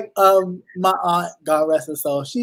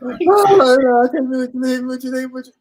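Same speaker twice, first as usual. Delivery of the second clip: higher, fast, hammed-up grief; mock wailing; 7 semitones higher than usual. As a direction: fake heartbroken mourner. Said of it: "They be so heartbroken".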